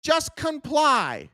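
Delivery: pleading with the rebels to give in, mocking whine; mock loyalist. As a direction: drag out a sneering mock plea to obey. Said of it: "you go".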